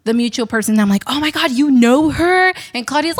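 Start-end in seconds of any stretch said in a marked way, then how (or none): 1.1-2.5 higher and louder, thrilled disbelief; excited gasp. Retold or imitated. Retelling meeting a mutual friend, excited. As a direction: gush like a starstruck fan.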